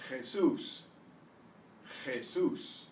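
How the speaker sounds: "Jesus" as kheh-SOOS, stress on the end; Spanish pronunciation. Shown as a demonstration. Teacher modelling the Spanish name, "Jesus" as kheh-SOOS. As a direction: say "Jesus" with throaty J and long stressed "sus". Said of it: "that's where the stress is".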